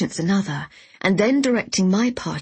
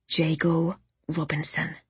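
deliberate naming; 2 semitones lower, 4 dB quieter than usual. A narrator's reading. Orla gives a name to remember.

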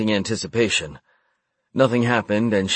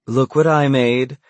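slow proud call, louder; happy; 3 semitones higher, 4 dB louder than usual. A narrator's reading. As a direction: show it off delighted. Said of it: "she said happily".

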